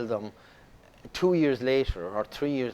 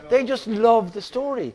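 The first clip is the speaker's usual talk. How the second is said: normal talking voice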